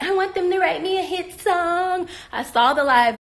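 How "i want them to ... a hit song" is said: slow, high whiny mimicry; mock a rival's plea, drawn out and pitched up; mocking imitation. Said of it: "You keep going in your life like".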